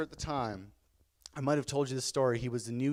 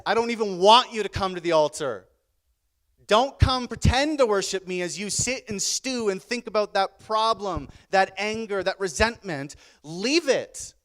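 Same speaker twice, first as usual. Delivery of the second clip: loud, high preaching voice; impassioned, insistent pulpit rebuke; forceful command; 4 semitones higher, 7 dB louder than usual. Retold or imitated. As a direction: command the congregation as if speaking for Jesus. Preacher voices Jesus' stern command.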